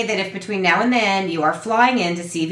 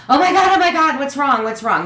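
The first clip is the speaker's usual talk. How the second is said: high, louder mock alarm fading down; mock panic; 7 semitones higher, 4 dB louder than usual. Daughter retelling her panicked call back, mock alarm. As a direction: rush the words like bad news. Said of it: "I zoomed to the phone".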